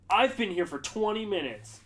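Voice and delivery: high, loud mock complaint; imitated indignant shout. Staffer mimicking the diner once seated, indignant shout. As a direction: holler it like an impatient diner.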